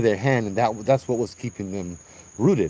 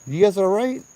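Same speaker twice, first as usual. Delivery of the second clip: concerned; 10 semitones higher than usual. Retold or imitated. Checking on group after wind.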